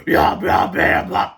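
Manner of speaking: low, loud monster chant; evil toilet cry. A narrator's reading. The evil toilet menacing the boys, low loud chant. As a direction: growl it like a hungry monster toilet. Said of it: "cried the evil toilet".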